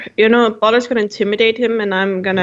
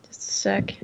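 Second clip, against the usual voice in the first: quick hushed whisper off to the side; whispered aside; 10 dB quieter than usual. Real, not acted. Player pauses mid-roll, aside.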